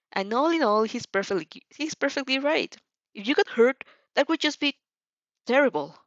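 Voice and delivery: a fired-up rival promising to win; hammed-up hot-blooded boy on the phone; passionate boy. Inasa voiced by reader.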